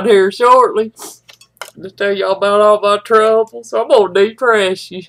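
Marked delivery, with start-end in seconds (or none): none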